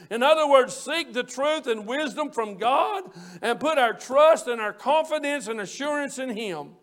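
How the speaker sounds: normal talking voice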